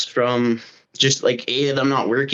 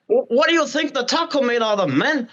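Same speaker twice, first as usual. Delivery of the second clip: higher Scarface-style Mexican accent; 8 semitones higher than usual. Shown as a demonstration. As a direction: redo the Mexican accent, bigger. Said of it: "let me hear your Mexican accent again".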